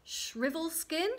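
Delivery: slow, high, rising question; tentative guess. Acted. Queen tries another name, questioning. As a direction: try the name out, lifting at the end.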